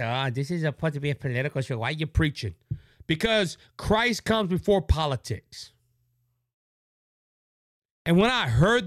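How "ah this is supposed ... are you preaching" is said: low, quick, softer mock whine; mocking listeners. Host mocking listeners who call his show political, quick low sneer. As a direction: brush it off in a lower, fast sneer. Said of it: "And people say".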